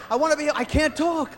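ordinary talk

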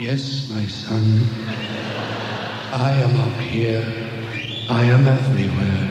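low, slow, booming divine voice; solemn God answering the man on the cliff. Acted. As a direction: speak as the Almighty from the clouds.

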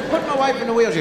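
normal talking voice

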